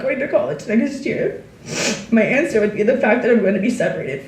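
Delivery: tearful farewell to her best friend, voice breaking; teary voice. Real, not acted. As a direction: read through rising tears. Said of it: "I don't wanna cry".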